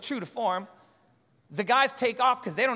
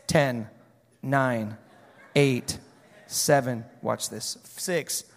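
low, slow countdown at the tomb; mock countdown; 9 semitones lower, slower than usual. The disciples counting down at the tomb, mock excited. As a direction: count it down like a crowd waiting.

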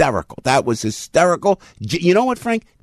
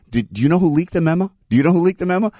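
lightly voiced old senator, a touch higher; a mild mock of a probing old senator; imitating Grassley; 6 semitones higher, faster than usual. Trump's rally bit, Grassley questioning Feinstein, lightly imitated. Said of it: "Grassley talking to Feinstein".

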